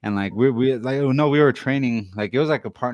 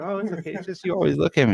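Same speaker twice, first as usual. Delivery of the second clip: imitated girl; 8 semitones higher than usual. Retold girl excusing herself.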